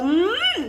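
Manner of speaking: long, high "mmm" of relish; demonstrated savouring sound. Shown as a demonstration. Coach shows followers their sound, savouring hum. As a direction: let a tasty hum climb and linger. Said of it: "korang punya bunyi tu".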